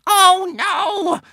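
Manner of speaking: very high wail of dismay; anxious witch. A narrator's reading. Witch losing the crowd, wailing anxiously. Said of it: "hissed the witch, looking round anxiously".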